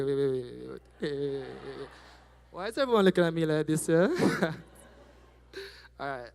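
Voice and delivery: normal talking voice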